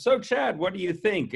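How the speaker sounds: a quick hint of Roger Dean, high and bright on the name; quoting Roger Dean. Podcast guest retells Roger calling Chad, lightly voiced. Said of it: "and he says".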